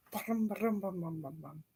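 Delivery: put-on car motor sound; engine noise.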